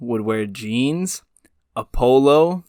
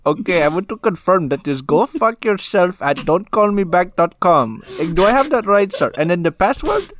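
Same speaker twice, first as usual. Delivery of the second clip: scam caller voice; 8 semitones higher, 5 dB louder than usual. Retold or imitated. Scammer voiced, high and loud.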